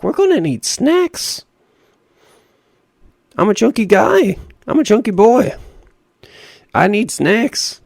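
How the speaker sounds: high, comic whine for snacks; playful mock plea. Gamer joking to viewers about needing snacks, playful high voice. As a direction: pitch it way up, joking insistence.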